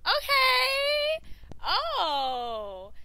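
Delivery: high, loud squeal of approval; wowed by what was just heard; impressed reaction. Real, not acted. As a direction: exclaim way up high, then let it fall. Reacting to her old singing.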